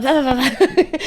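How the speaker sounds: overexcited babble. Retold or imitated. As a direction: imitate yourself babbling with excitement. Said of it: "I get dead excited".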